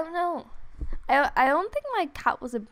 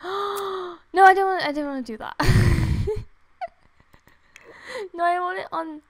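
fast, loud, high yelp of protest; panicked protest; 4 semitones higher, 7 dB louder than usual. Real, not acted. Gamer objecting to the game's option, panicky protest. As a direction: gasp and rush out a loud refusal. Game does something she doesn't want.

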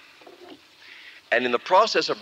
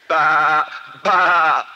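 loud bleating goat noise; 3 semitones higher, 7 dB louder than usual. Retold or imitated. Preacher mimics the goat that interrupts his prayer, loud bleating.

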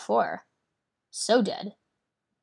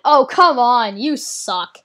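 exasperated shout; 3 semitones higher, 11 dB louder, slower than usual. Real, not acted. Player protests the opponent's card, exasperated shout. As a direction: shout it in frustration.

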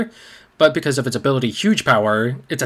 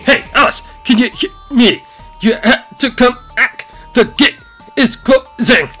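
high, loud slow calls from afar; urgent calling out; 12 semitones higher, 8 dB louder, slower than usual. Acted. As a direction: shout a warning across distance.

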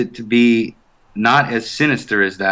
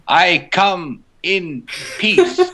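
very slow, higher put-on voice; slow Tonto-style speech; 10 semitones higher, much slower than usual. Actor imitating Hatham greeting her in slow movie-Indian talk. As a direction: drag out each word like a movie cliché. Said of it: "Why are you speaking so slowly?".

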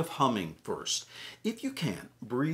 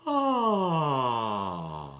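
yawning sigh; 4 dB louder than usual. Shown as a demonstration. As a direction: open yawn sigh on ah. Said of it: "Do a couple more".

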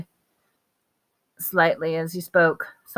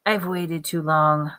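hissed menace. A narrator's reading. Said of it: "he hissed".

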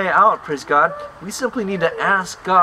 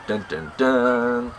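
ominous dun dun dun; 5 semitones lower, 3 dB quieter, much slower than usual. Pretend. Ominous suspense sound effect.

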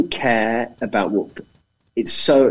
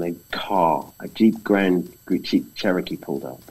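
ordinary talk, nothing marked

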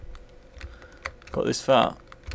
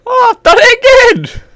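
very high, loud triumphant yell; exultant shout; 15 dB louder than usual. Real, not acted. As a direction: scream it with sheer elation. Celebrates another success in game.

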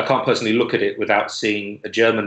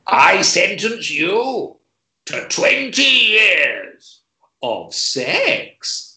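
high, very slow pompous judge; 10 semitones higher, much slower than usual. Acted. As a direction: hold each word like a grand verdict. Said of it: "got Sex Judge".

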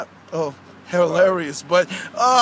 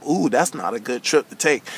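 ordinary talk, nothing marked

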